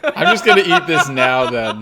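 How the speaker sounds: laughing while eating the apple. Real, not acted. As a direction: giggle through the words. Eats the last god apple.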